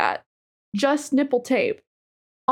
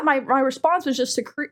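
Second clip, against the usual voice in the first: normal talking voice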